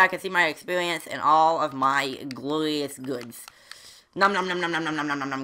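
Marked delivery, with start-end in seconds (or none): 4.1-5.4 rapid chanted gobbling; greedy munching noises. Pretend. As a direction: nom quickly and steadily like scooping it all up. Gobbling up the dropped items.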